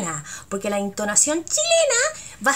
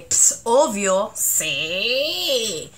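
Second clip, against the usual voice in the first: smug obvious; much slower than usual. Shown as a demonstration. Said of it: "Así como que".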